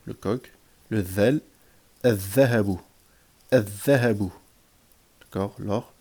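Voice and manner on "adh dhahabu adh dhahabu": "adh-dhahabu" with a held double dh, no L; doubled first letter. Shown as a demonstration. Teacher drilling sun-letter words, doubled dh. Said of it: "on va doubler".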